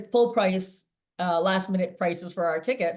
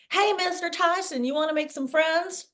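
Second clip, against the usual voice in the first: yelled out; 9 semitones higher than usual. Retold or imitated. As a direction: shout to catch his attention, tipsy and fearless. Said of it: "And I yelled out".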